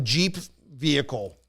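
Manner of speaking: ordinary delivery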